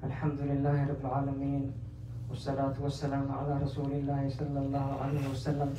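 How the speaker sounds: low, soft, quick recitation; Arabic khutbah formula. Real, not acted. Preacher closing one part of the khutbah, low formal recitation. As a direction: recite the set praise quietly and evenly.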